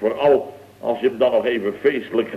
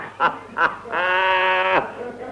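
a big braying laugh that goes up high; 6 semitones higher, 3 dB quieter than usual. Acted. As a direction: burst out laughing at her fear. Laughs at wife's fright.

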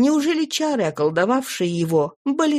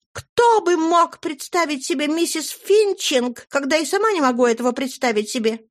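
high, fluttery chatter; gushing lady; 7 semitones higher than usual. Acted. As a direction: gush like a flustered old flame. Flora's gushing speech.

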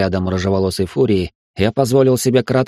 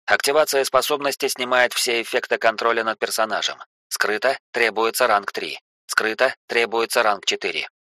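softer, even notification read; system message voice; 5 dB quieter than usual. Acted. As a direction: read it like a game interface prompt. Long system description of archetype.

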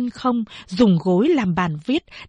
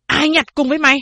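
angry demand; 6 semitones higher, 4 dB louder, faster than usual. Acted. Old man demands who helped.

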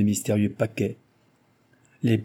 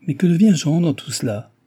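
higher, louder worried question; troubled voice; 7 semitones higher, 6 dB louder than usual. A narrator's reading. Susanna asks after her missing fiancé, voice troubled. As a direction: ask with a touch of alarm. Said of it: "d'une voix troublée".

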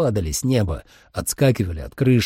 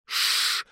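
sharp unvoiced "shh"; soft hissing shush; hissed shush; 7 dB quieter than usual. A narrator's reading. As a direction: hush them with a hiss. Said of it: "прошипел Трой".

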